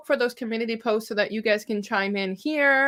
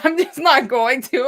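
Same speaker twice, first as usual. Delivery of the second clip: laughing; 4 semitones higher, 7 dB louder than usual. Real, not acted. Laughing while refusing to say.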